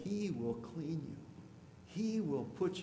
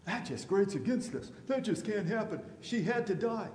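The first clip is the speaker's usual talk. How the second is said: louder, higher, scandalised mock church folk; mock outrage; 7 semitones higher, 6 dB louder than usual. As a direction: ham up the shocked churchgoers. Mocking church's reaction to divorce.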